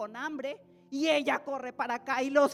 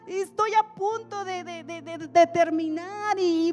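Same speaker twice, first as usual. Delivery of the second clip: higher, louder, stretched-out excuses; a busy student stalling the call; pleading excuses; 6 semitones higher, 9 dB louder than usual. Acted. A university student begs the Lord for more time, higher and pleading.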